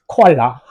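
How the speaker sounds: "코알라" tossed out loudly between laughs; native-speaker imitation. Shown as a demonstration. Teacher joking about native pronunciation to students, amused imitation. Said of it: "원어민 발음을 들어보세요".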